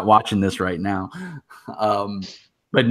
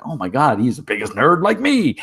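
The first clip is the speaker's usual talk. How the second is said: excited gush; 6 semitones higher, 4 dB louder than usual. Retold or imitated. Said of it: "you're like".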